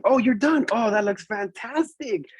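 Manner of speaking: high, drawn-out teacher enthusiasm; gushing praise. Retold or imitated. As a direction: gush over a small child's artwork. Teacher gushing over finished work.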